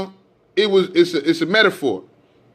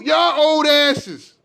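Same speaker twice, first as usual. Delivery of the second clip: angry scolding; 9 semitones higher, much slower than usual. Real, not acted. Scolding the elders directly.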